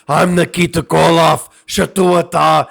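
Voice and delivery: slow, high mock Russian accent; fake Russian accent. Fan imitating a wrestler's fake Russian accent. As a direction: ham up a fake Russian strongman. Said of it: "he had this accent".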